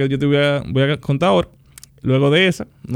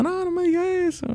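much higher, pleading quote of a crying mother; retold crying mother; 3 dB quieter than usual. Mother crying at her son's farewell, high tearful plea. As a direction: imitate his mom's tearful, high-pitched protest. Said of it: "Y ella llorando".

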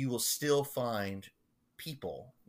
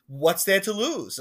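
put-on loud, high, insistent Long Island accent; Gordon's Long Island accent; 9 semitones higher, 9 dB louder than usual. Parishioner pushing his doubtful pastor, Long Island accent. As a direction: toss it out like a brash old Long Islander. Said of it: "and this is how he talks".

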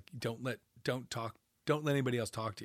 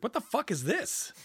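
high, louder indignant retort; mock outrage; 10 semitones higher, 4 dB louder than usual. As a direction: blurt it out as a scandalised director. Mike reacting angrily, retold.

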